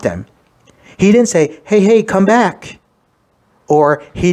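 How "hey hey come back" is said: mock calling out. Imagined Jesus calling followers back.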